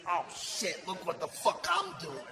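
soft, put-on crazy guy; crazy stitcher. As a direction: marvel quietly like a crazy man. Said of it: "he was kinda crazy".

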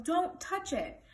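high, slow, emphatic warning; shouted warning. A narrator's reading. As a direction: stretch it out to stop someone. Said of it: "shouted Bartholomew".

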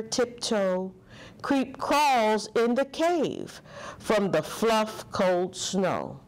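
ordinary delivery, nothing marked

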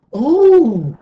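very high, loud drawn-out "oh"; big awestruck exclamation; gasping in wonder. A narrator's reading. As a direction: gasp out in amazement. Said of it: "they gasped".

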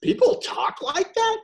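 amazed question; imitating incredulity. Friend imitates freaked out Baltimore man, high disbelief. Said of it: "was also going".

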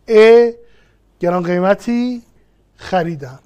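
each word set apart by a pause. Shown as a demonstration. Teacher separating the example sentence into words, word by word. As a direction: list the words one at a time, leaving a clear gap after each.

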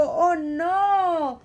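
plain reading voice

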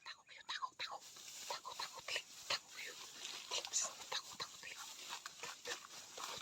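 urgent soft whisper; whispering while hiding. Real, not acted. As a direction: hiss orders while hiding. Telling others to hide, hushed.

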